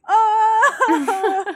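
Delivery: high, loud, drawn-out ooh; shocked delight. Retold or imitated. Fan reacting to June's savage comeback, shocked.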